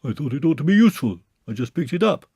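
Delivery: bear's voice pitched high; lightly voiced simple bear; Pooh. Pooh tells Christopher Robin how he found the pole, a lightly voiced bear.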